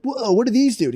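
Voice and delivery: high-pitched imitation of amazed onlookers; mock amazement. Retold amazed viewers of the show.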